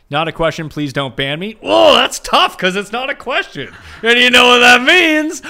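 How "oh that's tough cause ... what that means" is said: loud, high, hammed-up verdict; mock stern announcer. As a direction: boom it like a judge. Mock banning a listener.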